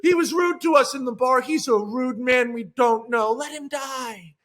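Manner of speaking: loud and high, slowing to a flat let him die; exasperated shout. Retold or imitated. Jack urging David to leave the man. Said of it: "And Jack is going".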